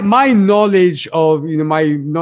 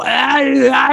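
high, strained imitation grunts; playful imitation of screen grunting; mock battle grunts; 10 semitones higher than usual. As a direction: ham up the gladiator's pained yelps. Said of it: "He does the whole".

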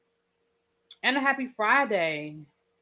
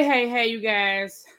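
ordinary delivery

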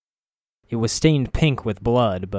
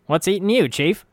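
higher, cheeky sidekick voice; wisecracking skull voice; 9 semitones higher, 3 dB louder than usual. A narrator's reading. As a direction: play the jokey floating skull. Mort's voice.

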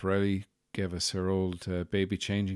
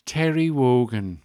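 slow, loud, lightly imitated Scottish woman; Scottish accent; 4 semitones higher, 7 dB louder, slower than usual. Coffee vendor finally naming who he resembles, hint of Scottish accent. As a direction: land the name slowly in a Scottish accent. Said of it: "who were lovely and Scottish".

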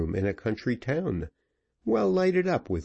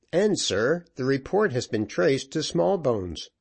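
higher, lightly voiced corporal; eager report to his officer; corporal's voice; 6 semitones higher than usual. A narrator's reading. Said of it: "replied Vanslyperken".

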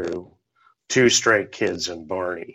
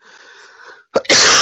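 a real, loud coughing fit; 8 dB louder than usual. Real, not acted. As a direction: cough hard and clear your throat. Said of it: "Excuse me. I'm gonna be coughing again".

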